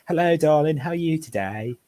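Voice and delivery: a light mock Mockney accent, a posh Londoner faking Cockney. Shown as a demonstration. Showing friends the Mockney accent he detests, in a lightly mocking Mockney accent. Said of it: "So you get something like this".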